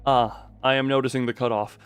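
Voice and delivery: ordinary delivery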